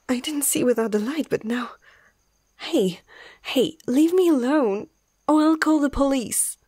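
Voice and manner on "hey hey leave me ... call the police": higher, panicked warning; frightened threat, pitch jumping up; alarmed. Acted. Vet warns off a stranger grabbing her, alarmed.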